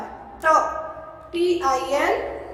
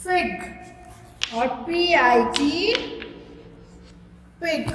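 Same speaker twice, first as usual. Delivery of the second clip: slow spelling out; much slower than usual. Shown as a demonstration. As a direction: spell each letter very slowly, then the word. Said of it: "तो". (बच्चों को धीरे-धीरे अक्षर जोड़कर सिखाना)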